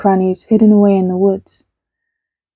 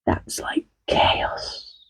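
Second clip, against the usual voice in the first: whisper; 12 dB quieter, much slower than usual. Acted. Explaining word dramatically.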